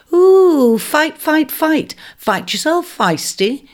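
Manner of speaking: high impish sing-song mockery; taunting barbs. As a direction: needle him like a cheeky sprite. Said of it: "came more taunting barbs".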